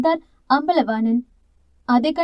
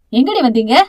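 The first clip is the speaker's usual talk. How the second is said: louder, hostile challenge; aggressive question; 5 dB louder than usual. Acted. Aunt charging at the girl's family, aggressive. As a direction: confront them as they arrive. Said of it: "ஆக்ரோஷமாக கேட்டார்".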